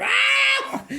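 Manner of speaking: high, loud cry of pain; sharp pained shriek; pain yelp. Retold or imitated. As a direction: yelp as the sore foot goes into the boot. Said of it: "I'm going".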